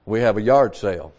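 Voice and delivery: usual speaking voice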